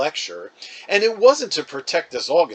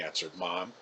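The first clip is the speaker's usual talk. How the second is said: plain reading voice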